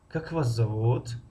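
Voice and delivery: slow, зовут stretched and lifted at the end; lingering rise on зовут; rising ending. Shown as a demonstration. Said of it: "интонатсионная конструкция се мешавад".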